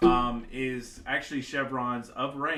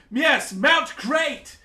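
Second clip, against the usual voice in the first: excited king; 11 semitones higher, 13 dB louder than usual. Acted. King urging the party to forge the alliance, shouting high. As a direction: roar it like an overeager king.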